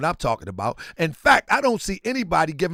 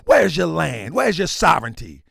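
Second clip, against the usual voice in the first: ordinary talk, nothing marked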